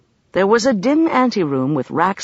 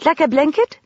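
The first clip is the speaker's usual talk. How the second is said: put-on child voice; 10 semitones higher, 3 dB louder than usual. A narrator's reading. Child character voiced by narrator.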